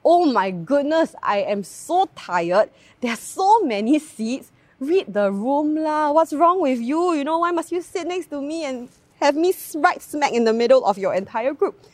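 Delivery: retelling her own annoyance at the tourists, mock exasperated; frustrated rant. Speaker retells ranting at tourists crowding her train row, mock exasperated. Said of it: "I was so frustrated".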